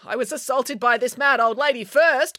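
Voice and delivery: high-pitched protest; indignant cry. A narrator's reading. April counters the woman's accusation, crying out. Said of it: "cried April".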